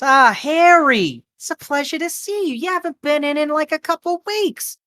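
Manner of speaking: high-pitched diner waitress fussing over a regular; friendly waitress. Acted. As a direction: greet a regular in a high, gushing voice. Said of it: "kinda walks by and goes".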